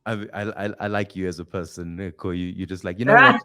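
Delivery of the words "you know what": mock rallying cry. Imitating Nico's rallying speech.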